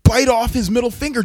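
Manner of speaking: rally your friends excitedly; retold eager proposal; eager pitch. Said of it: "Bill is like".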